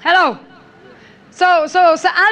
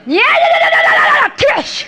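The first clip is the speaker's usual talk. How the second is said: imitating a shouting prophet; 10 semitones higher, slower than usual. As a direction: blast it out like a prophet in full flow. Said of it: "Nabi lain". (tiruan nabi yang berseru keras dan tinggi)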